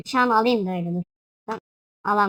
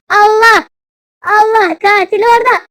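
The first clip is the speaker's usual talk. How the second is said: high, loud alarm cry; panicked shout; 9 semitones higher, 9 dB louder than usual. Real, not acted. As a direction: shout out in sudden alarm at the killer. Killer suddenly appears in game. (panikle bağırarak)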